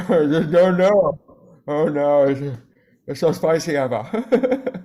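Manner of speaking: mock spicy panic. Burnt by spicy olive oil.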